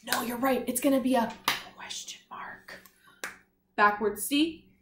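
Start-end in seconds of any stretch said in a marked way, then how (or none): 1.8-2.8 soft whisper, a little slow; teasing whisper of the answer. Shown as a demonstration. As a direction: let the answer slip out as a hushed whisper.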